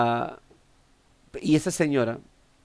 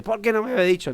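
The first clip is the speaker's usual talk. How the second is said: surprised question; 8 semitones higher, faster than usual. Retold or imitated. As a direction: pitch jumps high in surprise. Visitor surprised at old woman.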